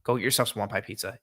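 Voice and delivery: ordinary delivery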